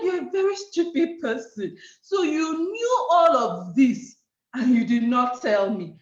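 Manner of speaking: emphatic acted rebuke, slowing down; berating husband. Husband scolding wife for keeping it from him, indignant. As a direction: imitate an indignant husband laying blame.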